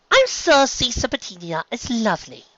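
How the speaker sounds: delicate Rarity. Acted. Rarity tactfully refusing the serpent's offer. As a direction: speak delicately, choosing words. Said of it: "Rarity said delicately".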